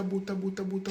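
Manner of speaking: ordinary delivery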